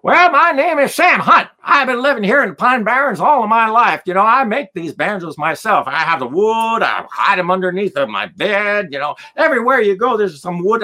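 put-on high-pitched, rattling old-timer; Sam Hunt. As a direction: imitate an old man talking without taking a breath. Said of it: "talking fast and furious".

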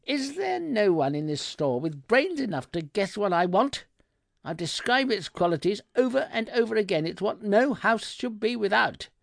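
high-pitched indignant tirade; cold fury. A narrator's reading. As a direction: rant at the useless shop assistant, pitch held up. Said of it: "my voice as cold as the devil's heart".

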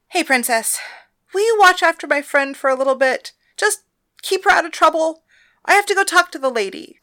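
high, loud friendly request; bright request. Acted. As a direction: ask the favour brightly. Thalia asking favour at door.

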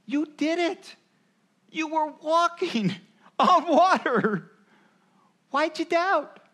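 high, excited praise with a laugh; cheering Jesus. Retold or imitated. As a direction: cheer Peter on like a proud parent. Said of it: "that's what Jesus is saying to Peter".

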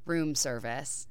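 low, soft and slow; hushed, low-key movie line; understated retold answer. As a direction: drop it quietly after a pause, acting the kid's reply. First take, [name]'s answer.

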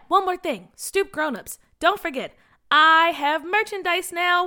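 ordinary delivery

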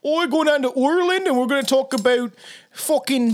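put-on a jokey stab at an Irish accent; lightly done Irish lilt; mock Irish accent. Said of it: "I can't do an Irish accent".

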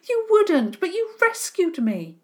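stunned at the betrayal; shocked. A narrator's reading. Runaway boy shocked his rescuers would turn him in. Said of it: "Jacob looked surprised and shocked".

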